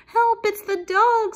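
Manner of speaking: high, loud cry for help; frightened storybook mouse squeak; panicked squeak. A narrator's reading. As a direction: squeal it out in alarm. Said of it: "squeaked Melissa".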